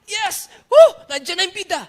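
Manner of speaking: put-on a kid jumping up, cheering loudly and high; excited cheer. Preacher voicing a little brother cheering, high and loud. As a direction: shout for joy like a child seeing his hero.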